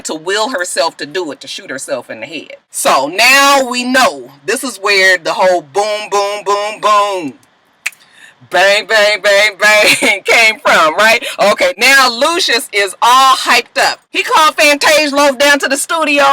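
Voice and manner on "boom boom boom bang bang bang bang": slow mock gun booms and bangs; imitated gunshots. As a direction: beat out the gunshots with your mouth.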